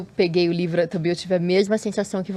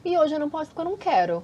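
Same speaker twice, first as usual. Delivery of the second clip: a quick, higher, cheeky no; blunt refusal; 8 semitones higher, faster than usual. Retold or imitated. Host adding the honest answer a mother could give, quick cheeky refusal. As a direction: toss it off fast and unapologetic. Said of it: "Também pode ser essa resposta".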